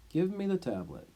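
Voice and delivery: ordinary delivery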